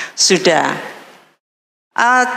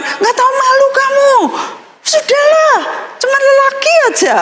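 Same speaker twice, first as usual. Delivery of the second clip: father scolding. Retold or imitated. Korean father telling her to stop crying over a man, high scold. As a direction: brush off her heartbreak as a scolding dad.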